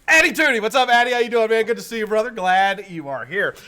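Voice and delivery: big, loud, high-pitched greeting; booming welcome, easing slightly at the end; hyped shout-out. Real, not acted. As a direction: hail a buddy like a live-show host. Hailing a viewer by name.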